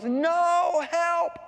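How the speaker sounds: high, drawn-out cry for help; falling scream. Acted. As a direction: yell loud and high as you plummet. Raindrop cries as it falls.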